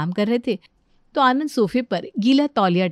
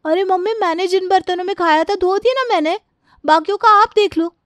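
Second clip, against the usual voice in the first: put-on much higher girlish character voice; defensive daughter; 10 semitones higher, 5 dB louder than usual. Daughter excuses herself from washing others' dishes, high and defensive. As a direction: brush mother off in a high-pitched voice.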